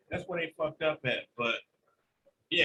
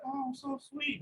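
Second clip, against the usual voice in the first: cat acting sweet; 7 semitones higher, 9 dB quieter than usual. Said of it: "be like".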